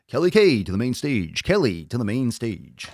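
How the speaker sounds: loud announcer call; mock emcee calling the winner up; stage announcer. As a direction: boom it out like a club announcer.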